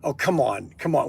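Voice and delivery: usual speaking voice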